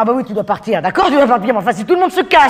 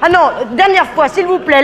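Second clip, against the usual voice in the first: ordinary talk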